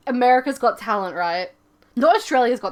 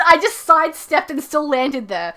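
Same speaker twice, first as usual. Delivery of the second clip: ordinary talk, nothing marked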